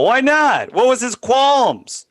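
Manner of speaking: high, drawn-out disbelief; incredulous. Real, not acted. Co-host aghast at the friend's refusal, incredulous. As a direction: protest in real bafflement, voice leaping up.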